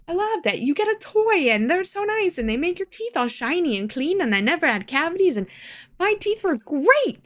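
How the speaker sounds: high, sing-song gushing about the dentist. Real, not acted. Gushing to viewers about how much she loved the dentist.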